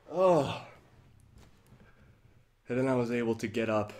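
ordinary delivery

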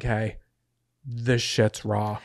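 slow, low, drawn-out pronouncement; slow mock-serious declaration; mock deadpan verdict. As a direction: drag it out like a grave verdict. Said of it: "And then you're like".

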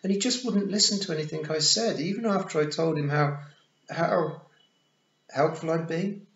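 put-on high, loud boy in a rush of excuse; flustered blurting. A narrator's reading. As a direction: pour out a nervous justification.

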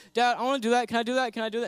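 put-on high, loud, pestering little boy; eager kid. Peter as a kid begging his dad, high and loud. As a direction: beg like an excited child tugging at dad. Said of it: "And he's like".

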